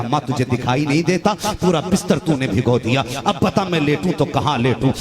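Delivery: loud, angry scolding. Retold or imitated. Son angrily scolding his mother over the soaked bed. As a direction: let the anger out at her. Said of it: "गुस्सा होके कहने लगा".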